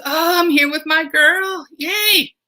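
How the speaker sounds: high, gleeful "Yay"; joyful Tonio. Acted. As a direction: cheer about being with his girl. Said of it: "happy and joyful".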